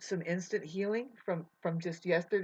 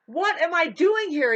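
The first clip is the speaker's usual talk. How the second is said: much higher, louder "What am I doing here?"; bewildered cry; 9 semitones higher, 9 dB louder than usual. Retold or imitated. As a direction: shout it out in bewilderment. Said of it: "And I'm like".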